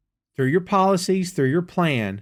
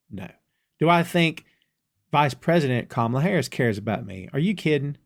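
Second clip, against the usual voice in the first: ordinary talk, nothing marked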